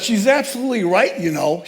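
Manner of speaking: loud, high New York stranger taking a side; New York accent. Retold or imitated. Stranger at the crosswalk defends the wife, New York accent. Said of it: "she said in a New York accent".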